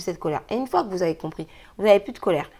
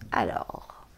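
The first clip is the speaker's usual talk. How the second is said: low breathy whisper on "Alors"; 12 semitones lower, 5 dB quieter than usual. Real, not acted. About to read cards to viewers, soft whisper. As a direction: breathe out "Alors" before reading.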